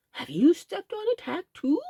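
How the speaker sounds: a hint of a kindly rabbit; lightly voiced rabbit. A narrator's reading. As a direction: ask the sick friend kindly. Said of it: "asked Uncle Wiggily".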